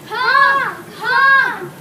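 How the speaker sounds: loud high shouts; shouting honk. Real, not acted. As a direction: yell it at the passing cars. Shouting at passing cars.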